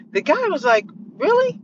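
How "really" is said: much higher, quick rising "Really?"; surprised "Really?". Retold or imitated. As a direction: shoot the voice way up in disbelief. Guy surprised son is engineer.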